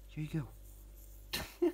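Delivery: slow, soft unvoiced offering; softly whispering to a shy spider. Real, not acted. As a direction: whisper it gently, drawn out.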